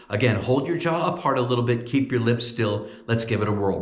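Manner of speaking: ordinary delivery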